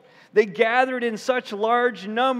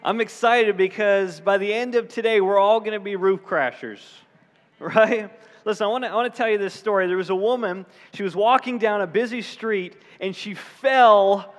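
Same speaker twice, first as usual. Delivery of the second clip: ordinary talk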